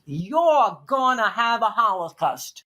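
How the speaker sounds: put-on slow, heavy Brooklyn accent. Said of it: "In that Brooklyn accent".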